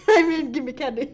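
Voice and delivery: laughing, high correction. Real, not acted. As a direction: giggle through the correction.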